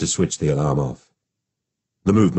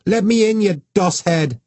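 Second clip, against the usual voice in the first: put-on furious child. A narrator's reading. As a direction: yell through the door in a tantrum. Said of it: "Her little fists beat against the bathroom door in rage".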